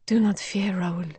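soft, breathy near-whisper; gentle hushed reassurance. A narrator's reading. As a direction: soothe him gently, under the breath. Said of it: "she added gently".

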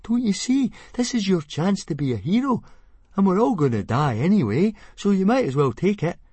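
put-on high, loud boyish urging; urging friend. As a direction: push your friend to be brave. Fishlegs urging Hiccup.

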